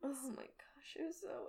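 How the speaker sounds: overwhelmed whispered gasp; awed whisper. Real, not acted. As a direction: barely get the words out.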